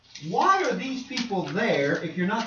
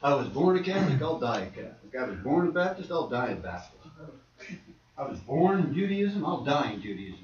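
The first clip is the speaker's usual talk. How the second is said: low, soft imitation of set-in-their-ways believers; stubborn resignation; 6 semitones lower, 6 dB quieter than usual. Lifelong church member refusing to change, mock resigned. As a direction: let each vow drop low and quiet. Voicing people stuck in their tradition.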